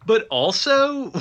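ordinary delivery

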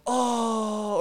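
high, drawn-out gasped oh; crowd's shocked oh. Retold or imitated. Retelling the arena crowd reacting to a fat guy, shocked oh. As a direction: mimic a stunned crowd. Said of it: "people in the crowd go".

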